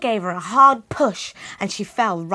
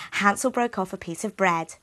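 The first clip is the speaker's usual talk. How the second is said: ordinary delivery, nothing marked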